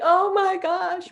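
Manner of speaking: slow, high, choked-up gasp; tearful student gift reaction, slow and high; crying. Retold or imitated. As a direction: break down as you say it. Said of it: "right, crying".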